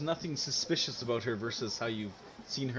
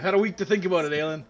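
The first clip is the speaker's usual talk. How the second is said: mock indignant; 5 semitones higher, 11 dB louder, much faster than usual. Real, not acted. GM protests player teasing.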